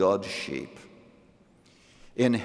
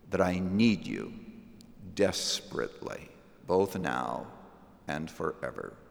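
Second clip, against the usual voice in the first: quoted prayer; 3 semitones lower than usual. Acted. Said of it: "I want to be able to say".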